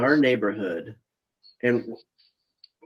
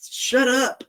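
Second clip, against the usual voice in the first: annoyed retort; 12 semitones higher than usual. Retold or imitated. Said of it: "And I'm like".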